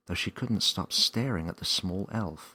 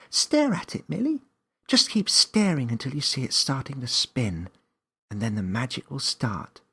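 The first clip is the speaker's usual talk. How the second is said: put-on high, bright little girl voice, louder; Claire's voice; 7 semitones higher, 7 dB louder than usual. A narrator's reading. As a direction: voice a young friend eagerly coaching.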